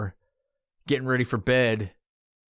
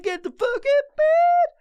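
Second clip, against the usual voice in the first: much higher, louder, slow high-pitched shout; frantic high yell; 5 dB louder than usual. Retold or imitated. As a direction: shriek it at yourself, dragging it out. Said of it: "I was just like".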